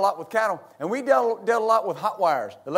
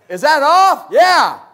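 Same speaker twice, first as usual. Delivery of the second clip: loud, raised-pitch holler; calling back and forth; 4 semitones higher, 9 dB louder, slower than usual. Retold or imitated. Man in attic rechecking the switch, calling out. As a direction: imitate workers shouting between attic and floor.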